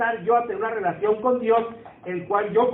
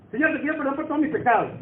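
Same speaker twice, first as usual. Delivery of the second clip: fast, careless rattled-off prayer, a little higher; rushed prayer; 5 semitones higher, faster than usual. Retold or imitated. Believer hurrying through a prayer to God, fast and offhand. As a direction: rattle it off to be done quickly. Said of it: "como para no hablar mucho contigo".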